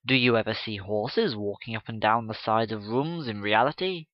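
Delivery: ordinary delivery, nothing marked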